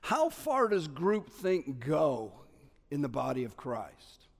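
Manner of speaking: normal talking voice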